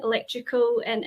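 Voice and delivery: ordinary talk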